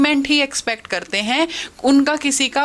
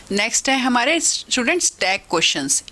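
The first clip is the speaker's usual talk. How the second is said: ordinary talk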